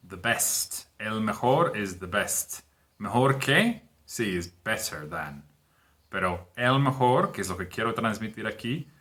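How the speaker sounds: ordinary talk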